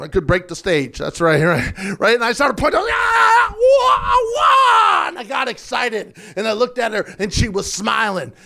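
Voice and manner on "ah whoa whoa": loud, very high kung fu cries; hammed-up high martial-arts shrieks; mock karate screams. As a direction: scream through a flurry of punches. Acts out excited karate punching.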